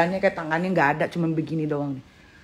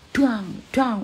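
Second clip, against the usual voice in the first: imitated banging; 6 semitones higher than usual. Imitating head banging on sofa.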